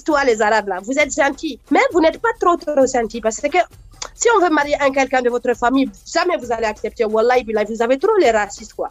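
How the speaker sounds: put-on mild Malian accent, teasing; a Malian friend teasing in a light Malian accent. Comedian voicing Malian friends teasing Arabs, light Malian accent. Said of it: "les Maliens".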